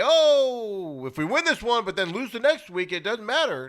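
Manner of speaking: exaggerated, high mockery of a coach's excuse; mock dismissive coach. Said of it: "You don't need to say".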